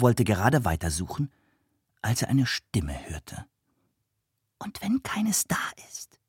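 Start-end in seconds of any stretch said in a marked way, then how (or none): 4.6-6.2 high, whispered small voice; a tiny creature's hushed question; bright little voice. A narrator's reading. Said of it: "klang es hell".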